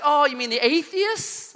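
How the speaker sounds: high, drawn-out mom voice, rising at the end; questioning mom. Retold or imitated. Mom on phone guessing who, high questioning voice.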